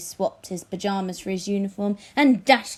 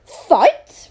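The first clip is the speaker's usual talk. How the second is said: high, loud questioning; puzzled repeat; 7 semitones higher, 12 dB louder than usual. Acted. King caught off guard, high loud puzzlement. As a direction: echo it in surprise. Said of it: "repeated King Fred".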